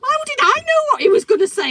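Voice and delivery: very high, loud self-defence; real indignant protest. Real, not acted. Woman defending herself to co-host, indignant. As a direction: protest it at full pitch.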